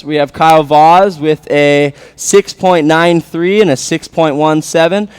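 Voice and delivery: loud high score announcement; laid-back surfer announcer. Real, not acted. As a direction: call the scores over the PA. Announces score update over PA.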